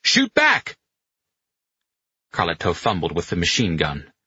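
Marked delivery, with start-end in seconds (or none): 0.0-0.8 high, urgent yell; shouted order. A narrator's reading. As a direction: shout it like an order under fire. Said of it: "Pino shouted at Carletto".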